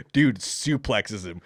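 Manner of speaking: usual speaking voice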